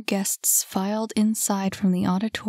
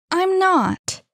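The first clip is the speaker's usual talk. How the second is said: high, loud, indignant retort; emphatic protest; firm denial; 11 semitones higher, 6 dB louder than usual. Acted. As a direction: cut off the accusation, pitched high and loud. Denies his stern accusation.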